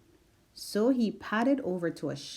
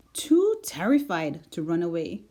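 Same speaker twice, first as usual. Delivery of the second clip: ordinary delivery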